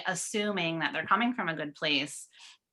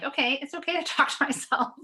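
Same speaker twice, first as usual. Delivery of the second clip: high, quick, laughing reassurance to herself; giggling as she coaches herself; laughing self-talk; 9 semitones higher, faster than usual. Retold or imitated. Podcast guest voicing her inner voice with a laugh.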